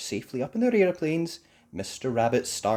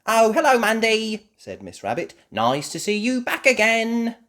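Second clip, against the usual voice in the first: very high, loud greeting; cheerful Miss Rabbit; 12 semitones higher, 9 dB louder than usual. Acted. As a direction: welcome an old friend back.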